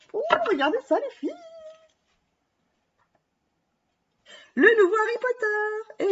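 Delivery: gushing over the new paper, pitch flying up; excited. Real, not acted. Vlogger showing viewers the new Harry Potter paper, thrilled and high.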